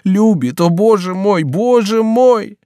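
a lover's pained outcry to heaven; anguished exclamation. A narrator's reading. The count to the servant, crying out in despair. Henri's overwhelmed outburst.

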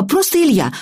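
put-on high-pitched child voice; girl echoing an adult. A narrator's reading. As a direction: repeat it back as a little girl, high. Girl echoes his correction. (высокий детский голос)

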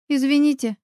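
ordinary delivery

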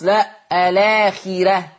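الآخرة with the راء made thin and light, stretched slowly; thin raa. Shown as a demonstration. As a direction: say الآخرة as Warsh does, not as Hafs. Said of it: "مش بقول آخرة لحفص". (الآخرة بترقيق الراء لورش)

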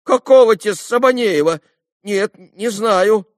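high, loud, baffled reply; bewildered protest. A narrator's reading. Lad quizzed about an unknown man, loudly bewildered. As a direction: answer utterly at a loss.

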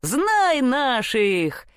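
high, long-drawn boastful call; boastful cry. A narrator's reading. Simpleton cries out riding his goat, boastfully. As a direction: stretch it out like a triumphant holler. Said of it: "закричал он".

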